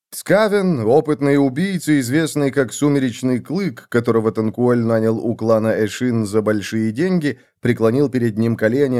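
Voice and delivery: ordinary delivery, nothing marked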